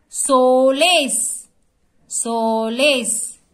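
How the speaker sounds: Solace in two slow, stretched beats; syllable by syllable. Shown as a demonstration. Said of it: "एक एक word हमको जोर से बोलना है".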